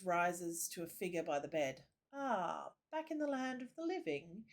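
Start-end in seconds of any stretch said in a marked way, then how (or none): 2.1-4.4 put-on a touch of a lilting accent, higher; lightly voiced lilting priest; a priest greets the waking soldier, lightly lilting. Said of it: "His accent, accent lilts like music".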